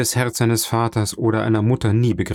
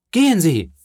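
crowd calling out; 8 semitones higher, 4 dB louder than usual. A narrator's reading. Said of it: "riefen alle Stimmen".

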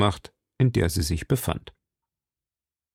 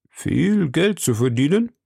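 higher, rising, interested question; intrigued echo question; 5 semitones higher than usual. A narrator's reading. Husband hears wife speak of money, intrigued. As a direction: echo the offer with eager interest. Greedy husband echoes wife's offer.

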